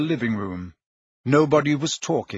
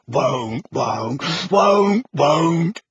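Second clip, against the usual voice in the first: elf refusal rising; much slower than usual. A narrator's reading. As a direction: build from a mutter to a yell.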